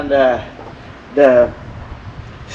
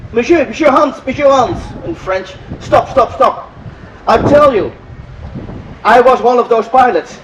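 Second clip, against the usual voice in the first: higher, urgent stop-stop; excited interruption; 5 semitones higher than usual. Retold or imitated. Old French pilot cuts in to say he flew them, excited. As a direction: cut in eagerly, pitch up, to reveal he flew them.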